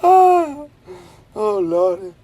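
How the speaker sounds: high squeal of laughter into oh Lord; cracking up, can't hold it in; laughing exclaim. Retold or imitated. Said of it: "She's like".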